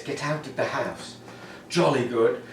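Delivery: soft mock-English ghost voice; hushed haunting in a comically English accent. Comic mimicking movie ghosts in horror films, soft English accent. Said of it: "And they sound like this".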